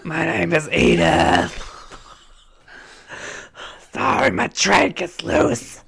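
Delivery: slow, hammed-up smoker's croak; Edith. Breaking up while playing a heavy smoker, exaggerated slow croak. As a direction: drag the words out in a rough smoker voice. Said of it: "Edith character is a very heavy smoker".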